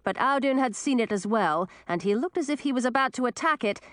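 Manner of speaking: ordinary delivery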